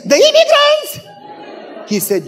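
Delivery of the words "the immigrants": very high, loud squawk of disbelief; hammed-up shocked wife, voice shooting up. Wife learning who the neighbor is, shocked.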